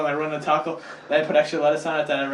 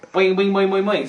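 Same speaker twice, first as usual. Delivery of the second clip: boing sound; 7 semitones higher, 6 dB louder than usual. Retold or imitated. Rabbits answer with a sound.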